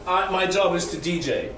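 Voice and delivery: normal talking voice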